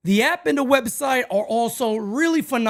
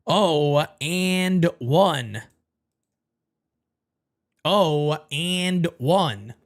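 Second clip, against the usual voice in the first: slow drawn-out count; 5 semitones lower, much slower than usual. Real, not acted. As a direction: stretch each word, very slow. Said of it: "drawn-out repeat".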